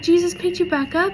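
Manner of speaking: lightly voiced mom, high questioning; retold mom. Mom checks what her girl said, imitated high question. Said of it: "she's like".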